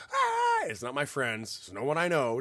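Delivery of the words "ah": high jeering crowd cry; mocking crowd shout. Thirty strangers jeering at a man waking up, high mocking cry. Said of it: "thirty people around me going like".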